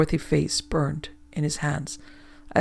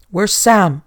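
much louder, urgent shout; 3 semitones higher, 10 dB louder than usual. Acted. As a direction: call out in alarm for the missing man. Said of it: "shouted Treach".

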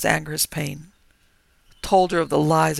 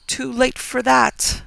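higher, slow, contemptuous voice; contempt; 9 semitones higher, 7 dB louder, slower than usual. Acted. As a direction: drag it out with scorn. Ghost scorns his prayer.

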